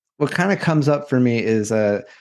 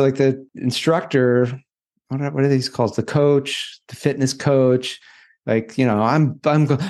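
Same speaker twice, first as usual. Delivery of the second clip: ordinary delivery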